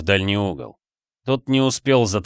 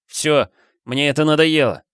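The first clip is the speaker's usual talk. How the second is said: fed up and firm, pitched higher; decisive; 6 semitones higher, 3 dB louder than usual. A narrator's reading. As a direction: put your foot down firmly. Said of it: "решительно сказал Тайло". (решительно, с раздражением)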